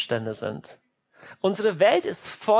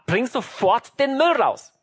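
higher, drawn-out parental command; insist, with each word slowed down; stern parent; 5 semitones higher, 3 dB louder, slower than usual. Acted. Acted parent, demand escalates. (strenger, nachdrücklicher Elternbefehl)

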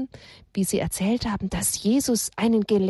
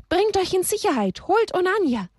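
urgent plea; 9 semitones higher than usual. Acted. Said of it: "schrie sie aus Leibeskräften".

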